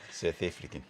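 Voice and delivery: brief mock South African accent. Shown as a demonstration. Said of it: "I could do South African".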